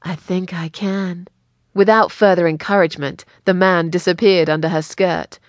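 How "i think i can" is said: slow, soft and husky. Acted. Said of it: "said in a husky voice".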